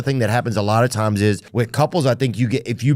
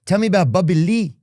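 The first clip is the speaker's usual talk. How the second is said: higher-pitched imitated fan; curious fan; 7 semitones higher than usual. Fan asking about his friend, curious higher voice. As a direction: ask as a nosy fan. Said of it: "A lot of them would be like".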